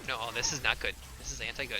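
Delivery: usual speaking voice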